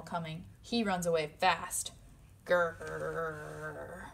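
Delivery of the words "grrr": low playful growl; bear growl. Acted.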